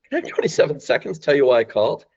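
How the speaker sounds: quick, lilting playful question; curious, inviting, rising as you ask. Shown as a demonstration. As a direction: ask it playfully, a little chuckle in the voice. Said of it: "I switch my voice to being playful and curious".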